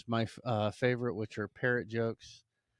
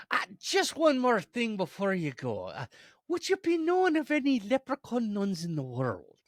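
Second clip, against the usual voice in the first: high, loud, lilting leprechaun; politely persistent leprechaun voice; 12 semitones higher, 6 dB louder than usual. Acted. Leprechaun pressing Mother Superior further, high eager lilt.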